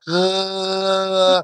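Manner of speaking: put-on baby's disgusted ugh. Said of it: "my baby's like".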